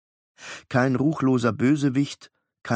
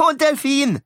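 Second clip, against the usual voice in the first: put-on much higher, louder insistent boy; eager demand; 5 dB louder than usual. Beckett adding the dolphin, high eager demand.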